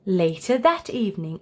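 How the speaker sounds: usual speaking voice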